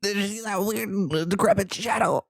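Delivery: high, hushed startled gasping; spooked whisper at the screen. Real, not acted. Gamer spooked by a shadow, high and hushed.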